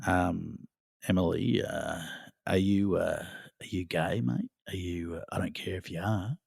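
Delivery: low, hesitant Aussie movie-villain mumble; Ben Mendelsohn. Acted. Guest springing an Animal Kingdom line on Emily, deep and halting. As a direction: drop the pitch and murmur haltingly, softly. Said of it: "that was Ben Mendelsohn".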